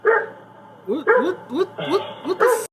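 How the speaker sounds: stunned and cracking up at what he sees; incredulous laughing what-what; shocked disbelief. Real, not acted. Shocked at the video clip.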